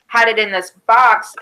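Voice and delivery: normal talking voice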